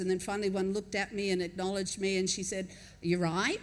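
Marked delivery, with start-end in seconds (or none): 3.0-3.6 low-starting question with a sharp rise; shop clerk's query. Retold or imitated. Deli clerk first acknowledging a newcomer, quick rising query. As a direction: casually check on a customer, imitating a clerk.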